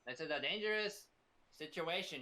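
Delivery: ordinary delivery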